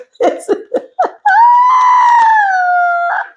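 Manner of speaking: shrieking burst of laughter. Real, not acted. Laughing at the enemy.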